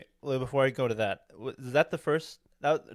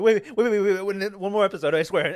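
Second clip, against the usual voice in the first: much higher, louder rush of begging; frantic pleading; 8 semitones higher, 9 dB louder than usual. Retold or imitated. Kid told to leave mid-show, frantically pleading. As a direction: act out begging to stay longer. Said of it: "I was like".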